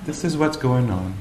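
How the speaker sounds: ordinary talk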